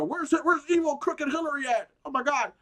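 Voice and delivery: high, loud hysterical squeal; mock conspiracy nut. Mocking MAGA believers hunting for Hillary, high and loud.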